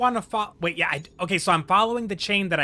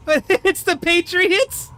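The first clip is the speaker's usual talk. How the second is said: high, slow, louder disbelief; incredulous; 10 semitones higher, 5 dB louder, much slower than usual. Real, not acted. Viewer incredulous at the guessed episode. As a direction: drag it out in disbelief.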